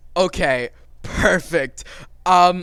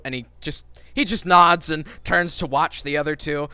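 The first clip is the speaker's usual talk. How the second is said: normal talking voice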